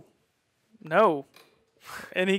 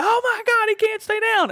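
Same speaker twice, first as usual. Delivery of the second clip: much higher, excited crowd voice; amazed crowd; 4 dB louder than usual. Retold or imitated. Wrestler voicing the crowd amazed he keeps kicking out, very high pitch. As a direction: squeal it like stunned fans.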